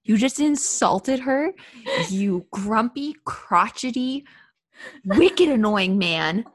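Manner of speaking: each insult spoken slowly, one at a time. Pretend. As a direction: spit out every insult slowly and separately. Scolding Murtagh, word by word.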